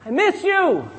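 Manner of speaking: high, drawn-out cry of devotion; high-pitched heartfelt holler; calling out. Shown as a demonstration. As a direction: call it out high and long, as if to someone far off. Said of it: "yell out, say".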